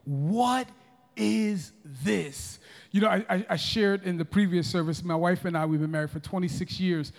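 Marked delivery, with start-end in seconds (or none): 0.0-2.6 slow, hushed wonder, word by word; amazed. Acted. Amazed crowd asking each other, drawn-out wonder. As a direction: draw it out in mild astonishment. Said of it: "they were all amazed".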